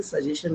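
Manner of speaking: ordinary delivery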